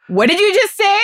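high-pitched, sassy challenge; incredulous, sassy comeback; indignant sass. Retold or imitated. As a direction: demand it with mock outrage, pitch jumping up. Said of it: "the Shaniqua in me came out".

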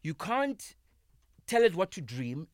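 usual speaking voice

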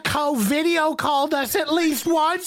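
high, hammed-up reproach; mock scolding, high. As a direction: tease him with a high put-on complaint. Mock scolding of the returning cohost.

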